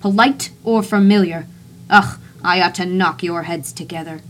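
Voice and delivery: put-on loud, impatient call at the boys; shouting. Narrator shouts at his boys in the car, annoyed. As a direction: scold them loudly. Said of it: "I shouted to the boys".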